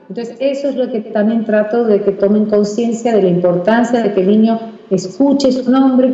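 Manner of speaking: ordinary talk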